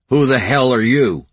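gritted teeth, loud and high; through clenched teeth. A narrator's reading. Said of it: "Brady asked through clenched teeth".

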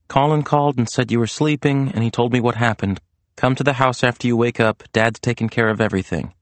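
Serena's voice. A narrator's reading. Voices Serena's text message.

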